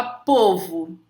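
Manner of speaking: povo with closed stressed o, "pôvo". Shown as a demonstration. Teacher giving another example, closed o in povo. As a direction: say povo with the first vowel as ô. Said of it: "você fala com timbre fechado".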